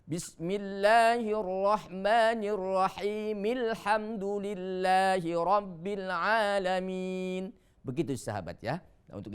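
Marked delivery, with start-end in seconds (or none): 0.0-7.5 slow chant linking "Bismillahirrahmanirrahim" into "Alhamdulillahi" as "rahimil-hamdu"; wasol recitation. Shown as a demonstration. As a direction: recite both without stopping, hamzah of "Alhamdulillahi" silent. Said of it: "kita bacanya di wasol". (bacaan wasol, "Bismillahirrahmanirrahim" disambung ke "Alhamdulillahi")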